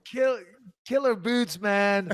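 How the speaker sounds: high goofy voice; Dumb and Dumber quote. Retold or imitated. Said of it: "Shannon's face".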